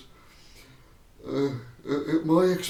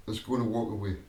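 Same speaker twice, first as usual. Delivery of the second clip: ordinary talk, nothing marked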